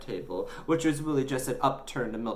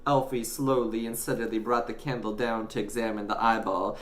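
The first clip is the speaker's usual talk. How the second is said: ordinary delivery, nothing marked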